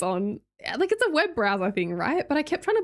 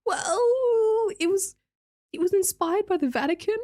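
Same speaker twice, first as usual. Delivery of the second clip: sheepish confession; 10 semitones higher than usual. Retold or imitated. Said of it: "I was like".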